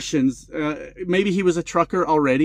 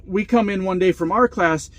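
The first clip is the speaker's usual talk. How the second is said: usual speaking voice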